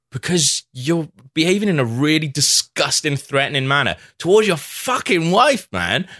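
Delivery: high-pitched, preachy telling-off. Retold or imitated. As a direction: lecture him with mock righteous indignation. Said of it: "That's getting really preachy 'cause I was hammered".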